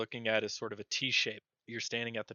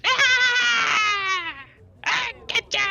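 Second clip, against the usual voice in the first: tiny creature shrieking, much higher and louder; high squeaky critter shriek; 16 dB louder, much slower than usual. Acted. Little critter ambushing the party, shrieking gleefully. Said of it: "and she goes".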